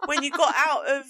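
loud, high, incredulous reproach; exasperated scolding. Retold or imitated. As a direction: re-enact losing patience with a parent. Acts out scolding mum about bag.

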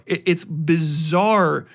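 usual speaking voice

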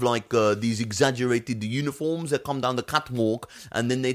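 high, breezy Alsace French accent, pretending to know fashion; a French driver at Fashion Week in a put-on Alsace accent. Esteban faking expertise at Fashion Week, Alsace French accent. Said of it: "given him an Alsace French accent".

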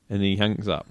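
normal talking voice

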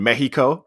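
"Mexico" said as MEH-hee-koh; Spanish-style pronunciation. Retold or imitated. Retelling where the float was made, "Mexico" as MEH-hee-koh. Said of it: "multiple times they say Mexico".

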